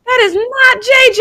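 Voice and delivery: high, rising mock shock; retold disbelief. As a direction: act out appalled disbelief, climbing very high. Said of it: "I was like".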